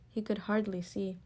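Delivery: usual speaking voice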